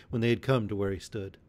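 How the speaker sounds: ordinary delivery, nothing marked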